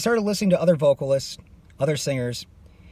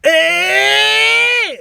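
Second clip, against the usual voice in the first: tiny high yelp, much louder; 9 dB louder than usual. Shown as a demonstration. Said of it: "like [name] small".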